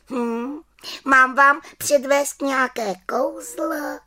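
high witch voice; little witch offering. Acted. Little witch offers the children a spell, high voice. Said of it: "zeptala se malá čarodějnice".

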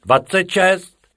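high, loud shout of a number; shouted count. Acted. Rabbit shouting a number to fake the headcount, loud. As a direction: call it out loud. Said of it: "vykřikl druhý".

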